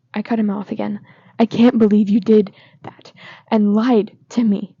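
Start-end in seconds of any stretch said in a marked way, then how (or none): 1.4-4.8 loud, the last words slowed; furious disbelief, slowing at the end; angry. Acted. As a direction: spit it out loud, then drag the ending. Nick cuts Charlie off, furious.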